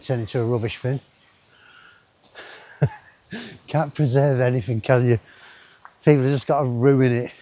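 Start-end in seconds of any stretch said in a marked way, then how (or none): none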